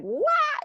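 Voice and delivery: a very high imitated baby wail; mock child wail. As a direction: wail like a toddler throwing a fit. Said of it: "the child is like".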